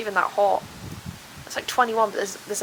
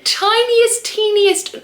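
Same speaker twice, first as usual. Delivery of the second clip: high mock-cute squeak; squeaky tiny voice; 11 semitones higher, 8 dB louder, slower than usual. Real, not acted. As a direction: squeak it out like something minuscule. Exaggerating how small the space is.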